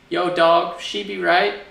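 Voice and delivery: "Yo, dog", hammed-up street slang; mock American slang. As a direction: play a dorky American dude. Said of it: "a lot of American culture is kinda like slang".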